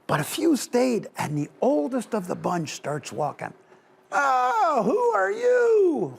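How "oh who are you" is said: much higher, slow squeaky gnome elder; little gnome voice. Acted. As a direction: pitch it way up like a tiny old gnome asking who's there. Tiny old gnome elder speaks.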